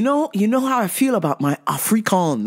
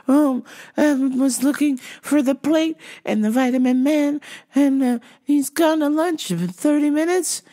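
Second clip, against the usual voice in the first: rambling, halting, higher-pitched customer; confused customer; 4 semitones higher than usual. Retold or imitated. Customer rambling to clerk about the vitamin man, high and confused. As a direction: trail through broken thoughts in a high voice.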